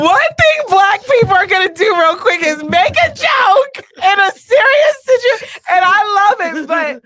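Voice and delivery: high, loud, half-laughing outburst; worked-up, high-pitched loud joking; emotional exclamation. Real, not acted. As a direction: exclaim it at full volume through emotion. Joking through tears after poem.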